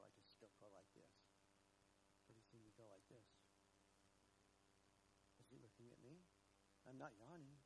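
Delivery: soft and low, almost to himself; hushed preaching. Acted. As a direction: pull them in close with a hush. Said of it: "gentle intimate preaching drop".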